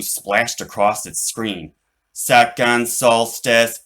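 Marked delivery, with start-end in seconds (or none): 2.1-3.8 slow, loud, mechanical; robot voice. Acted. Robot reports to Charlie, slow and mechanical. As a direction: spell it out like a machine. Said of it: "it said".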